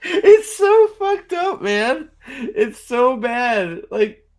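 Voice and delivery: high, slow, drawn-out words after laughing; helpless amusement. Real, not acted. Viewer losing it over a bad film, helpless amusement. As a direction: squeal it out, stretching each "so".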